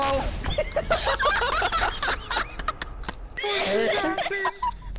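high-pitched giggling fit; high laughter. Real, not acted. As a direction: laugh helplessly. Friend dying in the background.

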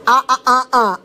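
"ah, ah, ah" voiced with lips apart, no closure; Coca-Cola tried with lips open, open-mouth "ah" sounds; lips not touching. Shown as a demonstration. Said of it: "Say Coca-Cola without your lips touching".

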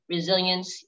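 ordinary talk, nothing marked

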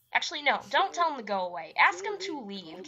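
ordinary talk, nothing marked

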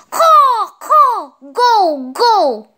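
high, loud crow calls; high-pitched squawking crow, short bursts. Acted. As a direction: caw shrilly to drive the intruder away. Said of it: "He has a very loud voice".

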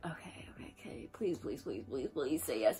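hushed, rapid whispered pleading; nervous pleading. Retold or imitated. Begging to herself after texting her crush, nervous whisper. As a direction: whisper it like an anxious little prayer.

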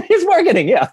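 "It's marketing." high and squeezed out through laughter; laughing hard. Real, not acted. Echoing Bert's joke about marketing, laughing hard. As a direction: crack up while repeating it.

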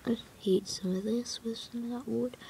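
soft unvoiced aside; whispering. Real, not acted. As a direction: whisper it to yourself. Mutters aside while playing.

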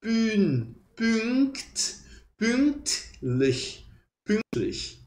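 "pünktlich" with lips rounded for U while saying I. Shown as a demonstration. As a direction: build up "Pün, pünkt, pünktlich" slowly. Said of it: "biquinho de U e pronuncia um I".

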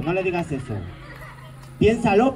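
ordinary talk

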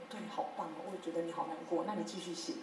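soft, offhand going-through-the-motions praise; perfunctory praise. Retold or imitated. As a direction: toss out hollow comfort without looking up. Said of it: "上去敷衍一下".

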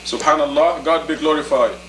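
ordinary talk, nothing marked

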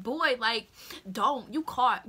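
loud, firm callout; calling him out. Retold or imitated. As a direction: tell him he's caught.

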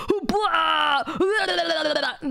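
exaggerated retching noises; imitated throwing up. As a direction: retch like the neighbor being sick. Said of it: "like, throwing up. He'd be like".

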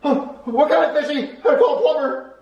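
high-pitched, frantic character voice; panicked urging. Acted. Stopping a friend vomiting on the fish, panicked urging.

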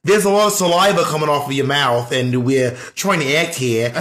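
hammed-up Australian actor grumbling; Australian accent. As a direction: keep up the mock Australian accent. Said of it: "definitely better than your British accent".

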